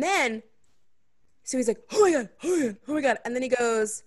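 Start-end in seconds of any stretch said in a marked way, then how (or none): 1.9-3.2 fast, breathless Oh my God; breathless gushing. Retold or imitated. Guy gasping after sex.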